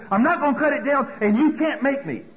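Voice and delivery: loud, high-pitched angry refusal; defiant yell. Retold or imitated. Son in the parent's face, defiant yelling.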